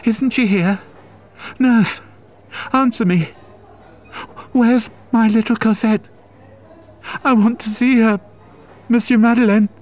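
desperate cry. Acted. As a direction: plead wildly for her child. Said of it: "she cried".

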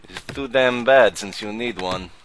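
loud, scornful "too damn bad"; scornful loud retort. Inspector scolding a traveller, loud and scornful. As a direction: throw it in their face.

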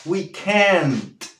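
CAN'T with a clearly released final T; demonstrated final NT. Shown as a demonstration. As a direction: pronounce "can't" slowly, landing the NT. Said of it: "N T, N T". (pronunciación de "can't" marcando la NT)

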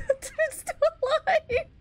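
giddy with relief, laughing through tears; relieved euphoria. Real, not acted. Player crying out in relief at surviving, high and laughing.

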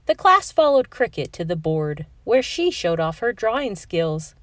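usual speaking voice

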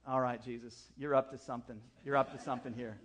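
low, softened aside in prayer; musing to Jesus. Retold or imitated. Bedtime remark to Jesus.

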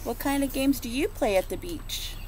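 ordinary delivery, nothing marked

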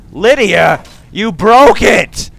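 high, loud, slow accusing shout; outraged shout at a clumsy companion; furious yell. Real, not acted. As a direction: bellow the blame at her. Yelling at companion over a stuck door.

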